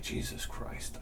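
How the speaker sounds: whispered under-breath sigh; quiet exasperated whisper to yourself; muttered curse. Retold or imitated. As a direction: breathe it out in dismay. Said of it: "And I'm like".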